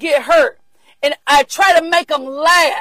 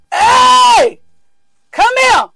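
sharp high call across the room; loud summons; 6 semitones higher, 4 dB louder, much slower than usual. Real, not acted. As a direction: shout for someone to come over. Calling out to someone.

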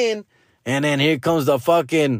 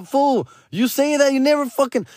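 scolding; 12 semitones higher than usual. Retold or imitated. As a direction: call out the broken promise. Scolding his kid, retold.

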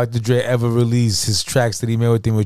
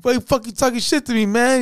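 very high-pitched imitated protest; squeaky, incredulous mock complaint; mock confrontation; 11 semitones higher than usual. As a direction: retell an indignant challenge in a high voice. Acted confrontation in studio story.

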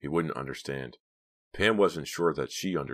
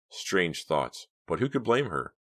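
ordinary delivery, nothing marked

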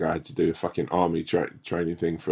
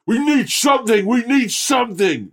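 much higher, hammed-up desperate plea; mock Ox voice; 5 dB louder than usual. The Ox begging for help in crossfire, high mock plea. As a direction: squeak it out like a cornered soldier. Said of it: "the Ox is like".

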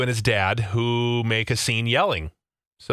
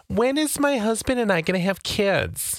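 nosy relative; 9 semitones higher than usual. Retold or imitated. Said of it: "having to be asked".

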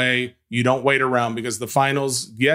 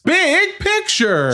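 high, slow hyped segment call; segment announcer voice; much slower than usual. Acted. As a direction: announce it like a show jingle.

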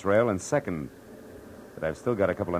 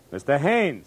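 higher, louder call across the distance; 6 semitones higher, 4 dB louder, slower than usual. Acted. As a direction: hail him from afar. Calling down to the balcony.

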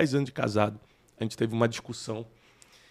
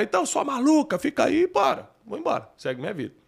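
imitando briga; 12 semitones higher than usual. Retold or imitated. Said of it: "se eu fosse um disfarce".

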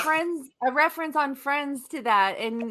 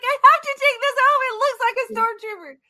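excited; 10 semitones higher, 6 dB louder, faster than usual. Retold or imitated. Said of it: "I was like".

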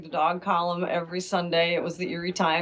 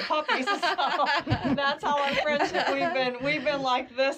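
higher voice breaking into laughter; laughing; 7 semitones higher than usual. Real, not acted. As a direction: laugh through the punchline and carry on. Laughs at her own punchline.

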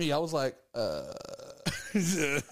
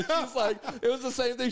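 normal talking voice

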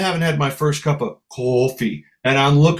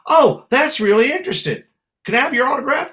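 higher, excited fan voice; gushing fan girl; 9 semitones higher, faster than usual. Retold or imitated. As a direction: play an eager young fan. Imitating fan girl asking autograph.

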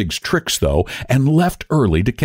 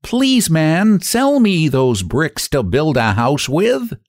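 high, slow pleading pig voice; pleading little pig; 6 semitones higher, slower than usual. A narrator's reading. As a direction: beg sweetly like a small pig. Pig begs the brick man.

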